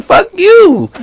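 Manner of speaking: high-pitched indignant outburst; indignant retort. Retold or imitated. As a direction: fire back in mock outrage. Acts his retort to commenters.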